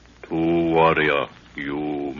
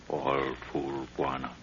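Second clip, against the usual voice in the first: softer, muttered contempt; hushed scorn; 8 dB quieter than usual. Acted. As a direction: mutter it with a quiet sneer. Mocking the sleeping guards.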